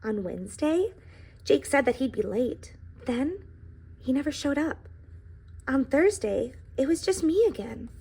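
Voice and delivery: storybook read-aloud voice; reading to children. A narrator's reading. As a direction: tell the pie's story to a classroom. Reads the book aloud.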